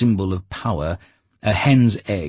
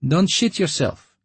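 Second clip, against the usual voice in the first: much higher, louder cheeky voice; encouraging Lyokha; 13 semitones higher, 4 dB louder than usual. A narrator's reading. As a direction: buck him up with a jeer. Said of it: "Lyokha encouraged him".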